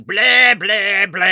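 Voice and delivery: loud, high mock blahs; imitated drunken babble. Woman mimicking her alcoholic brother on the sidewalk, loud babbling. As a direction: imitate her impression of her drunk brother, blaring each blah. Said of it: "she does it exactly like that".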